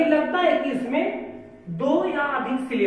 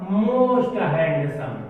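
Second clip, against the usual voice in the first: very slow, word by word; drawn-out teacher dictation; 7 semitones lower, much slower than usual. Shown as a demonstration. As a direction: spell it out slowly as you write on the board. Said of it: "हम ये भी कर सकते हैं".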